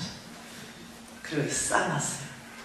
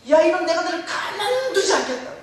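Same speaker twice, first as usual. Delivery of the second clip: loud, high-pitched yelling; raging threat; 10 semitones higher, 6 dB louder than usual. Retold or imitated. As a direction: roar the threat with everything bottled up. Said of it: "이러면서 난리 났어요".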